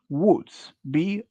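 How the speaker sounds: ordinary delivery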